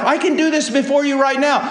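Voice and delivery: loud, high impassioned preaching; rising pulpit fervour; preaching at full force. Acted. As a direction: drive each line home to the congregation.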